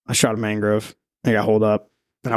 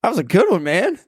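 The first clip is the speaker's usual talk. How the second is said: higher, lightly voiced buddy; buddy's reply; 9 semitones higher than usual. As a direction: tease him as the dive partner. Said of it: "And he goes".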